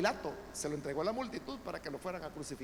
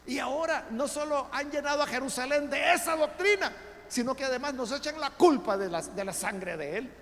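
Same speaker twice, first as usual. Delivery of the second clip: Sanhedrin scolding; 6 semitones higher, 5 dB louder than usual. Acted. The council scolds the apostles, a hint of indignant rebuke. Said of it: "Les dice".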